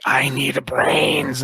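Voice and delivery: drawn-out monster craving; hungry symbiote. Acted. Symbiote demanding food from its host, slow monster voice. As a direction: stretch it out like a hungry creature. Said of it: "Starline's symbiote's like".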